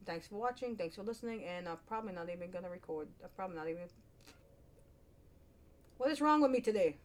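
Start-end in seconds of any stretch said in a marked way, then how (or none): 6.0-7.0 loud, high-pitched exasperated self-question. Real, not acted. Vlogger questioning own behavior after rambling, real exasperation. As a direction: burst out in exasperation at yourself.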